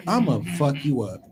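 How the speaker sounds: usual speaking voice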